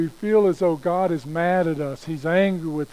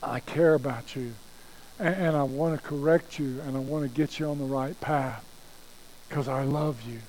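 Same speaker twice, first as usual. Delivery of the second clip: softer, tender reassurance; caring God; 5 dB quieter than usual. Acted. God tells us he cares, gently and lovingly. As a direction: speak gently, settling softer at the end. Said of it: "God speaks to us and he says".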